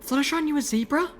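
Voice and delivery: very high, wide-eyed question; Velvet Remedy astonished. A narrator's reading. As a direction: ask it in a high amazed voice.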